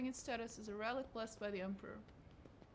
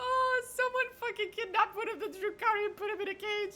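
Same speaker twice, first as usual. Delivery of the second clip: higher, louder words through laughter; laughing while talking; 12 semitones higher, 9 dB louder than usual. Real, not acted. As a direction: talk through the giggles. Laughing at caged captive.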